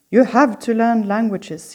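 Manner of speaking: put-on loud German-accented teacher yell. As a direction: bark it in a heavy German accent. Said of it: "he would yell at us in his heavy German accent".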